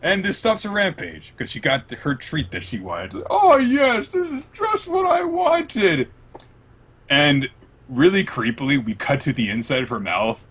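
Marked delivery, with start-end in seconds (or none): 3.3-6.1 high, slow, loud squealing delight; high drawn-out glee of a giant; greedy Big Mom. Retold or imitated. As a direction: gloat over a longed-for treat.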